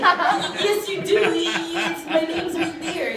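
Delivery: high stuttered mock voice; stuttering Gary. Stutter Gary insisting his name is on the pencil, high stutter. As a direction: stammer out the protest. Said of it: "Stutter Gary".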